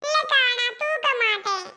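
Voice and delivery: put-on high-pitched small boy voice; little brother. Little brother promising sister not to lift the baby, piping voice.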